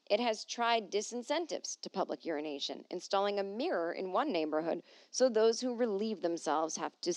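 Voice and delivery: normal talking voice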